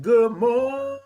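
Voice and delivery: high, loud, long-held MORNING; reading a greeting as a drawn-out call; signature good morning. Pretend. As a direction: stretch the greeting out like a sung note. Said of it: "that's how I say it".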